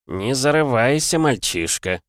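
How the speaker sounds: slow, drawn-out warning; lightly voiced godling. Minor god warns the cheeky hero, slow and lightly voiced. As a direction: put the boy in his place, stretching the words. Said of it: "произнёс другой божок".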